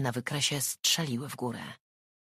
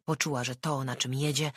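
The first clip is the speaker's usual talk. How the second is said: usual speaking voice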